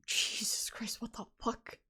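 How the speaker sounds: breath-only swearing, very soft; shocked whisper. Real, not acted. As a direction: whisper it in startled disbelief. Startled reaction under the breath.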